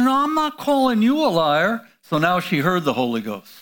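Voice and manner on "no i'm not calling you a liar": higher, quick defensive denial; defensive teen protest. Retold or imitated. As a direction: protest like a caught-out teenager. Leanne answers the Holy Ghost.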